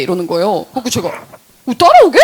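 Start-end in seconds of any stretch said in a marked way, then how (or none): 1.7-2.2 high, loud, incredulous check; retold astonished reaction; surprised question. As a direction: act out being caught off guard. Acted surprise at friend joining.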